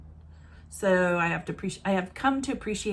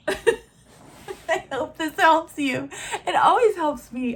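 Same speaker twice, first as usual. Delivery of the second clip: louder and slow, laughing through it; 7 semitones higher, 6 dB louder, slower than usual. Real, not acted. Repeating her sign-off to viewers, laughing. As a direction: laugh it out with self-mocking glee.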